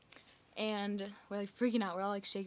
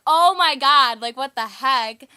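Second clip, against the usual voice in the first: very loud, high cry of alarm, slowing at the end; freaked-out exclamation; 4 semitones higher, 18 dB louder, slower than usual. Retold or imitated. Friends spot police lights behind them, freaking out loudly. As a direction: belt it out as a panicked retelling. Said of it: "we're, like, freaking out".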